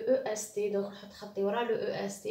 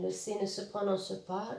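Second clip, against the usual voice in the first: ordinary talk, nothing marked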